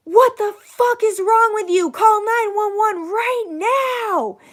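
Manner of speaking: furious order, high and loud, slowing at the end; retold man yelling at a bystander; angry outburst. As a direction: chew out a bystander, stretching the final words. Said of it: "he gets this angry look".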